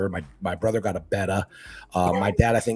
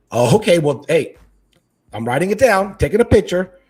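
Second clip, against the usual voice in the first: louder, higher, playful insistence; mock note-taking; 5 semitones higher, 7 dB louder than usual. Husband holding wife to her big tank promise, mock insistence. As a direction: make a loud show of recording the promise.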